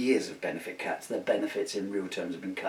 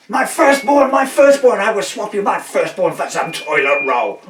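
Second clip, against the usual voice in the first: much higher, louder desperate pleading; a shopper in the pandemic bargaining for toilet roll, high and frantic; 10 semitones higher, 17 dB louder than usual. Retold or imitated. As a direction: beg like a crazed panic buyer. Panic buyers in the pandemic.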